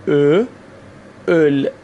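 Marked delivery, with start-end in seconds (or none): 1.3-1.7 اول with rounded lips and a drawn-out ö; rounded o-umlaut. Shown as a demonstration. Teacher shows Iranian learners a hard vowel, اول with long rounded ö.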